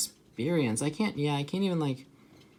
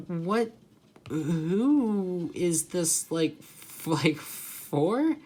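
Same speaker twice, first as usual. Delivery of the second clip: drawn-out, incredulous question; baffled, slow retelling of a reaction; acted bewilderment; 2 semitones higher, much slower than usual. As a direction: stretch it out in disbelief, rising at the end. Acting out bewildered reaction to game.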